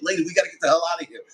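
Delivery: hurried retold urging; urgent plea. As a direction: rush it out to hurry someone along. Urging the lady to flee.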